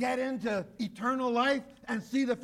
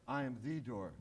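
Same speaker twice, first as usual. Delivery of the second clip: "I am the door" softer, with "the" lightly leaned on; softer repeat; 10 semitones lower, 10 dB quieter than usual. Shown as a demonstration. Preacher repeating Jesus' words to the church, soft and hushed. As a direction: let it sink in, softly. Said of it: "Not a door".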